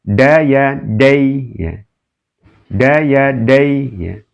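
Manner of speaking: "dai" said slowly, with the "ai" short and unlengthened; lin reading demo. Shown as a demonstration. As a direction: read "Daya dai" slowly, like a Quran teacher. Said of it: "dai pendek".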